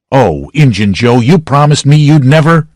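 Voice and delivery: loud, high, desperate pleading; cling to Joe's promise; desperate plea. A narrator's reading. Potter begging Joe.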